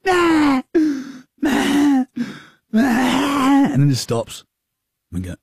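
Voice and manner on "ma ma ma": imitated baby wail. Dad imitating his crying baby, high wailing ma. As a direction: wail it like a newborn. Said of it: "He's going".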